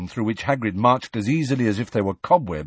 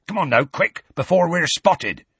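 higher, pressing rustic giant; urgent; 8 semitones higher, 4 dB louder than usual. A narrator's reading. Hagrid hurries the children into the trees, urgent. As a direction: hurry them along.

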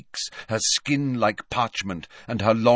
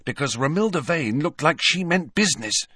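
high-pitched, mildly grim warning; a touch of grimness, pitched high; 9 semitones higher than usual. A narrator's reading. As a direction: warn a friend in a higher voice. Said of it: "said Hermione grimly".

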